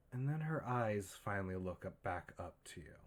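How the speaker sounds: ordinary delivery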